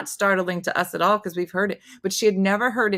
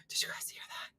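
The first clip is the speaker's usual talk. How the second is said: frightened whisper; imitating grandma's shaken whisper; 17 dB quieter than usual. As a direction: breathe it out, scared to be overheard.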